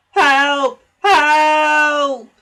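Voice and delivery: very loud, high desperate yell; cry for help. A narrator's reading. James shrieking for help as his brakes burn. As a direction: scream for help.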